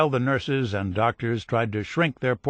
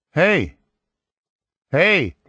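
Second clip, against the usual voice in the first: two loud calls of hey; yelling; 4 semitones higher, 7 dB louder than usual. A narrator's reading. Soldier yelling after the girl drops the phone. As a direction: shout down a dead phone line. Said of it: "he yelled".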